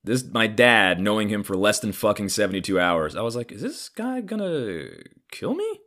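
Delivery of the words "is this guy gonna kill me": high, slow, hushed disbelief; mock alarm. Son wondering about his newfound dad, high hushed alarm. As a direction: climb to a whispery squeak on the last words.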